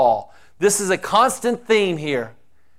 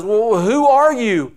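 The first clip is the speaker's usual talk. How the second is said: Saul asking; 5 semitones higher, 4 dB louder than usual. Acted. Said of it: "Saul says".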